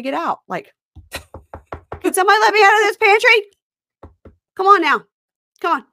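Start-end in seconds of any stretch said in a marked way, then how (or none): 2.0-5.8 put-on loud, high, hurried child's plea; Amira shut in pantry. Imitating a little girl knocking on the pantry door, loud high plea. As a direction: yell to be let out, imitating a trapped little girl. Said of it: "you can hear Amira, like, knocking".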